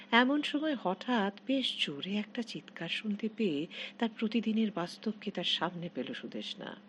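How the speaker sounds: ordinary delivery, nothing marked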